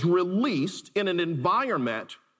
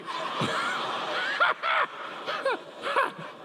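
big laugh. Real, not acted. Crowd laughs at the joke.

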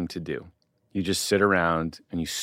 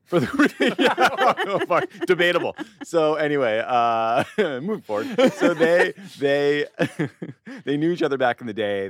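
talking through high giggles; can't stop laughing; 10 semitones higher than usual. Real, not acted. Cracks up at the terrorism joke.

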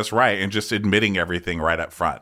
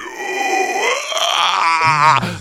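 shrieking laugh; 7 semitones higher, 5 dB louder than usual. Real, not acted. Laughing after shrieked impression.

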